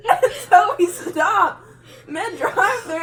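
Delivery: usual speaking voice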